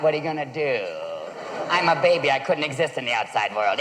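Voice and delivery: put-on low-pitched knowing baby; smug baby. Baby to cornered parent, low smug voice. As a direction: talk low, as if holding all the cards. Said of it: "got that look in your eyes like".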